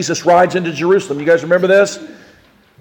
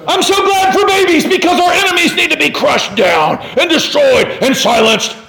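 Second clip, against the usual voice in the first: excited preaching shout; 9 semitones higher than usual. Acted.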